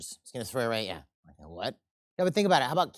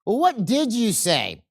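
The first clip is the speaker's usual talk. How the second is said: angry demand; 7 semitones higher, 8 dB louder, slower than usual. Acted. Angry man demands an answer.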